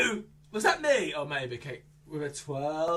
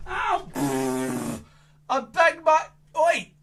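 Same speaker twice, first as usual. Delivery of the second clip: mock pain; 6 semitones higher, 7 dB louder, slower than usual. Pretend bump on puppet box.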